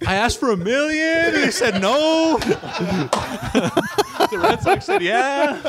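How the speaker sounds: high, giggling retelling; laughing through it. Real, not acted. Joking to friends about the draft, laughing throughout. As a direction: keep cracking up while you tell it.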